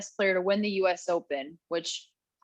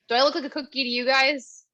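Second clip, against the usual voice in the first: normal talking voice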